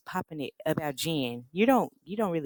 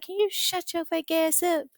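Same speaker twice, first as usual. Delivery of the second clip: high, altered-voice mock retort; mock snapping back; 11 semitones higher than usual. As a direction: clap back at the fake guest. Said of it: "And I'm like".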